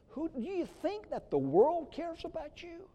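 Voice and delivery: ordinary talk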